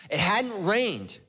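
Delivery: "It hadn't rained" dragged out slowly; slow heavy repeat for weight; slow emphatic repeat. Real, not acted. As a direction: let each word land, slowly. Repeated line, second slowed.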